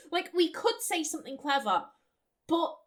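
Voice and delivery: ordinary talk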